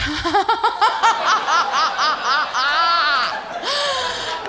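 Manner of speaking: long, high, staccato fake cackle; stoned driver's put-on laugh; forced laugh. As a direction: laugh mechanically and far too long. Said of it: "They laugh. So I was like".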